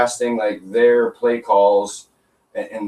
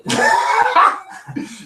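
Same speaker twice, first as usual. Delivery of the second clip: burst of laughter; 11 semitones higher than usual. Real, not acted. Reacts to cheating joke.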